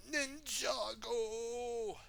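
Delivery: high, long drawn-out cry; battle cry. A narrator's reading. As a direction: stretch the word into a high rallying cry. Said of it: "he cried".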